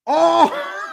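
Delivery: high, loud cry of disbelief; delighted cry. Real, not acted. Fan reacting live to his team scoring, delighted cry. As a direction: let the joy crack out of you. Goal scored, fan cries out.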